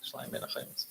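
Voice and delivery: hushed, as if telling a secret; whispered. Retold or imitated. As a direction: whisper the sign's words, all breath. Repeats the phrase unvoiced.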